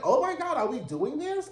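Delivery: high, startled oh my God; mock-scandalized viewer; shocked gasp. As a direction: react like the punch just landed. Reacting to on-screen punch.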